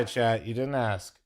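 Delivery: ordinary delivery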